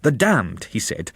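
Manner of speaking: plain reading voice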